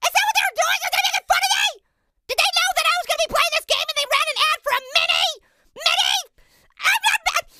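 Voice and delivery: indignant, high-pitched questioning; offended disbelief. Acted. Offended by mocking ad.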